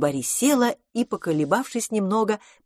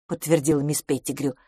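plain reading voice

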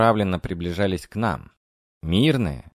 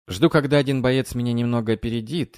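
ordinary delivery

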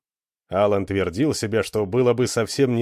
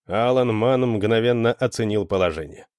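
ordinary delivery, nothing marked

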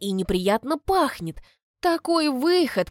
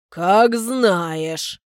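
shrugging man; 6 dB louder than usual. A narrator's reading. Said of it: "пожимает плечами мужик".

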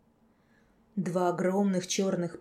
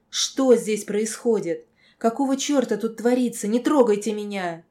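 furious; 3 semitones higher, 8 dB louder than usual. A narrator's reading. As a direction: rage at him to back off. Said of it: "дала волю не гневу, ярости скорее".

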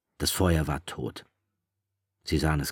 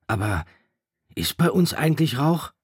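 put-on higher, louder worried boy; fretting boy presses Ralph; anxious Piggy; 10 semitones higher, 6 dB louder than usual. A narrator's reading. Piggy asking Ralph about the smoke, anxious and high.

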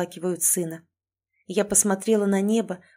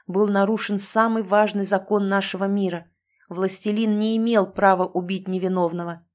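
ordinary delivery, nothing marked

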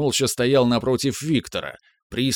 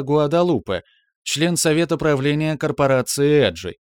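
ship computer voice; 4 semitones higher than usual. Acted. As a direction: report evenly like a ship's AI. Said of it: "продолжал мягкий женский голос".

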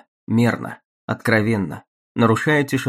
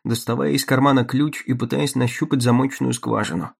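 ordinary talk

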